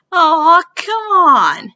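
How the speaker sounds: loud, high whiny complaint; loud groaning protest. Acted. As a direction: whine it like a disappointed crowd. Crowd protests.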